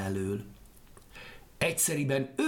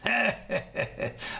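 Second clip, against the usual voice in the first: high wicked cackle; evil laugh; 9 semitones higher than usual. Acted. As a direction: laugh cruelly at him. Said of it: "csak nevetett gonoszul". (gonosz, magas kacagás)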